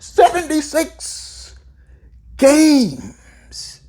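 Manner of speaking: high, drawn-out exclamation with a pause; slow, emphatic. Real, not acted. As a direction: repeat it slowly in amazement, pitched right up. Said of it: "I'm gonna say it slowly".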